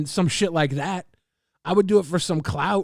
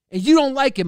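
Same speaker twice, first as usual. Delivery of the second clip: higher and louder, punching you; louder, higher jab; 7 semitones higher, 7 dB louder, faster than usual. Acted. Streamer repeating the jab louder at chat, escalating. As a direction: escalate the bark. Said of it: "I like barking".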